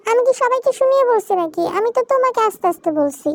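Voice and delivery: put-on high-pitched girlish protest; protesting child wife. Zara defending herself to her husband, high and protesting.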